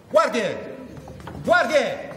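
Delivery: angry shout for guards. Acted. Calling the guards in anger.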